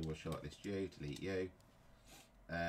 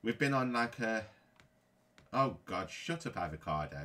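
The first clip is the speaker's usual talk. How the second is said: loud, half-laughing rant of anger; real angry outburst; 3 semitones higher, 7 dB louder than usual. Real, not acted. Gamer ranting to viewers after losing, loud and angry. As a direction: vent the anger with a laugh breaking through. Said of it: "so angry".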